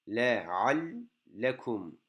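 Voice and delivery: Leallekum drawn out, the ll held; very slow model. Shown as a demonstration. Teacher slowly models Leallekum for students, doubled l held. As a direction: stretch Leallekum, leaning on the doubled l. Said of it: "lemin üzerinde şedde var".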